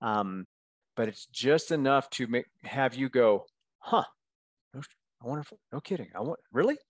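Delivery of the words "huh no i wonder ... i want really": quietly intrigued reader reaction, rising to "really?"; mock curiosity. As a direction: imitate a reader musing, softly at first. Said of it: "it's creating curiosity".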